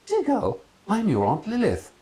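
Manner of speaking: theatrical eccentric aunt; grand aunt. Acted. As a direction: present herself grandly. Aunt introducing herself.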